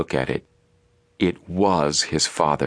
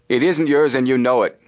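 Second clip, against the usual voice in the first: high, louder accusation; accusing; 8 semitones higher, 4 dB louder than usual. A narrator's reading. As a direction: call him out hotly. Frank accuses the man.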